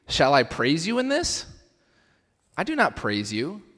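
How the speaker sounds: high, incredulous reading; stunned, incredulous Paul; pure disbelief. Acted. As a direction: read it as if you can't believe what you're hearing. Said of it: "In just pure disbelief, he goes".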